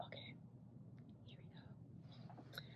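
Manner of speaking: hushed whisper. Real, not acted. Bringing viewers into the reveal, whispering. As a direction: breathe it out. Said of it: "whispered lead-in".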